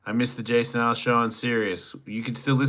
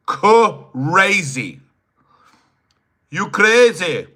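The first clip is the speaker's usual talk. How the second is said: high, loud put-on voice; loud mock wild voice, drawn out; crazy; 9 semitones higher, 12 dB louder, much slower than usual. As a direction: belt it out like a goofy character. Shouted crazy with odd voice.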